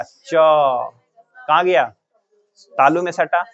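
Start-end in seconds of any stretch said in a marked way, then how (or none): 0.0-1.8 च कहाँ गया said very slowly, every sound stretched; drawn-out repeat. Shown as a demonstration. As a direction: stretch each syllable of च कहाँ गया to show the tongue. (धीरे-धीरे खींचकर)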